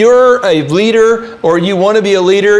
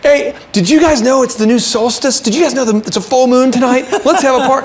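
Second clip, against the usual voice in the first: higher, bubbly and eager, speeding up in places; party guy; 6 semitones higher than usual. Retold or imitated. Imitating Captain Fun rallying the office, in a high excited voice. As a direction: hype every holiday like it's a party. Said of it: "Captain Fun".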